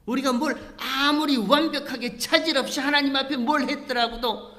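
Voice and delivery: loud, high impassioned plea; preacher voicing grieving Aaron, impassioned and loud; preaching at full force. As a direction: cry it out to the congregation. Preaching climax.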